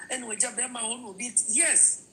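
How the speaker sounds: normal talking voice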